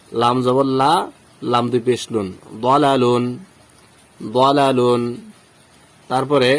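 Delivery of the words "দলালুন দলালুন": দলালুন twice, each opening on rounded "daw"; thick দ, long "laa" in দলালুন; heavy pronunciation. Shown as a demonstration. Teacher modelling the word twice, heavy rounded start on দলালুন. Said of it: "তাহলে কি হবে উচ্চারণ?".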